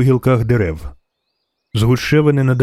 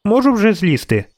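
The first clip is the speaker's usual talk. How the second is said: put-on high-pitched child's question; little girl; 9 semitones higher than usual. A narrator's reading. Girl up in a tree asks the witcher, high childlike voice. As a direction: voice a small girl asking permission. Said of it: "захована в листі дівчинка".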